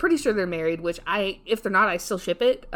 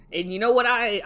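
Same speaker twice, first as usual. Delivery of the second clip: usual speaking voice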